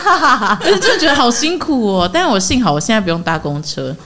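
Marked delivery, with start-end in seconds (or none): 0.0-2.1 high laugh, then a slow whine; laughing sigh. Real, not acted. Joking with a friend about being everyone's granddaughter, laughing. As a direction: drag it out through laughter.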